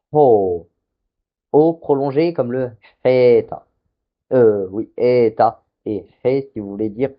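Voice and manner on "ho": "ho" breathy h, lower and louder; aspirated long ho. Shown as a demonstration. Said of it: "avec un esprit rude".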